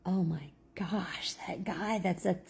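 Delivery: whispered gush; hushed excited whisper. Retold or imitated. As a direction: whisper it to a friend. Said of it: "she said".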